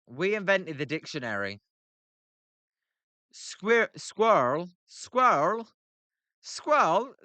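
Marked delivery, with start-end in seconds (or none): 3.3-7.1 "squirrel" said as one syllable, skwurl, higher and rising; rising one-syllable "squirrel" repeated; trying squirrel. Shown as a demonstration. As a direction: test "squirrel" over and over as skwurl. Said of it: "what are you talking about".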